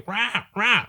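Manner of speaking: high squawking parrot call. Retold or imitated. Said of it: "how the parrots will be".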